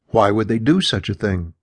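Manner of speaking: ordinary delivery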